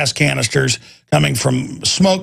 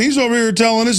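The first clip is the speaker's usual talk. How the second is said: usual speaking voice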